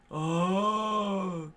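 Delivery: high, loud yelp of pain; pained cry. Acted. Marine kicked in the face, crying out. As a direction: yelp as the kick lands.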